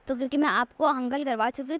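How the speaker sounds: usual speaking voice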